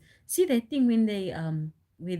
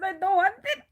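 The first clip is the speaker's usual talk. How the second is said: mock complaint; 12 semitones higher, 5 dB louder than usual. Complaining at the counter.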